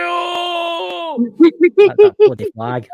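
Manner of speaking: high wailing mock outrage, near shouting; hammed-up cry of defeat; mock anguished cry. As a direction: wail it like a wounded clansman. MacDonald reacts to clan defeat.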